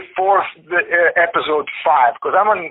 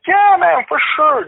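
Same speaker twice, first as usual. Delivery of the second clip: high, enthusiastic quoted yes; imitating an upbeat, all-in reply; eager agreement; 13 semitones higher, 3 dB louder than usual. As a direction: agree keenly in a raised voice. Choreographer eagerly agrees in retelling.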